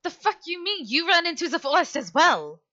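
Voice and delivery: high-pitched indignant comeback; indignant retort. Acted. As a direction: throw the blame back, pitched high. Arguing in character over blame.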